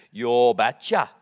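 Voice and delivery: slow, thick Norwegian accent, imitating his father-in-law. Imitating the Norwegian father-in-law giving his blessing, in a slow Norwegian accent. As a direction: do the Viking dad's Norwegian accent, drawn out slowly. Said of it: "in his thick Norwegian accent".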